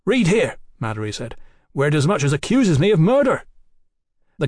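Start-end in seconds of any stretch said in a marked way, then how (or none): 0.0-0.6 high, insistent order; indignant demand. Acted. Mallory points to the accusation. 1.7-3.4 higher, affronted accusation; indignant protest. Acted. Mallory protests being accused.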